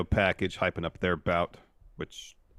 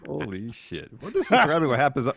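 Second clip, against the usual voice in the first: laughing; 8 semitones higher, 7 dB louder than usual. Real, not acted. Laughing after the joke.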